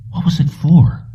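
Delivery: hushed, puzzled whisper; whispered wondering. A narrator's reading. Jennings puzzling over the receipt, whispered. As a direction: wonder it aloud under your breath.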